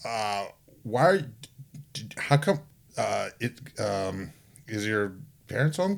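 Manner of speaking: hesitant, halting mock stammer; lightly acted bewilderment with broken starts; flustered salesman. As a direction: falter softly, searching for the question. Flustered salesman faces precocious child.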